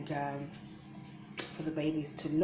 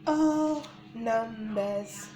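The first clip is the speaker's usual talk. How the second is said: slow sing-song teaching; 4 semitones higher, much slower than usual. Shown as a demonstration. Teaching numbers to babies.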